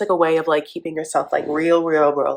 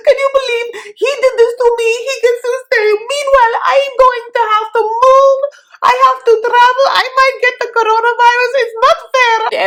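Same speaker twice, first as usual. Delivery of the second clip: much higher, louder pleading; hysterical mock Natalie; 5 dB louder than usual. Host as Natalie begging Tamara to go. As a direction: beg in a high frantic voice.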